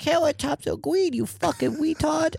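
put-on high, slow lisping kid voice; pinched kid voice. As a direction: squeeze it up into a lisping little kid. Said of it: "That's my go-to kid voice".

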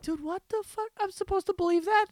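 high, incredulous outburst; exasperated disbelief. Retold or imitated. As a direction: squeak out your disbelief. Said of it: "I'm like".

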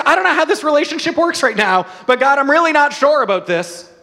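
a nervous leader questioning God, voice up high; Moses doubting God. Retold or imitated.

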